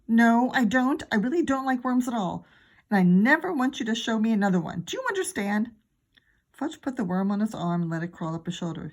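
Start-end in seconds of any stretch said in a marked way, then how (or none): 0.1-5.7 loud, firm mother's rebuke; stern scolding. Acted. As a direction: lay down the law. Mom scolds Fudge about worms.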